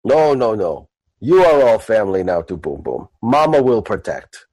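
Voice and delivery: low orc in broken speech; protective orc. Acted. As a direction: reassure them in a deep, simple voice.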